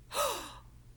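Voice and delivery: sharp high surprised gasp. Acted. Mister Mole seeing the ruined garden, gasping. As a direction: suck in a quick shocked breath. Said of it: "opened the gate and gasped".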